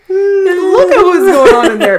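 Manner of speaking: high, loud, knowing tone; hint at something scandalous; high suggestive hmm. Retold or imitated. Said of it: "but I'm like".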